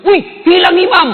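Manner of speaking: very high, loud, drawn-out mock yell; retold alarmed shout. Friend alerts the congregation to the vanished imam, yelling. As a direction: holler it like a startled boy, stretched out. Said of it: "Langsung teriak".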